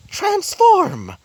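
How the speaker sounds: shrill squeal of transformation; high transform cry. Acted. Jet transforms, high voiced.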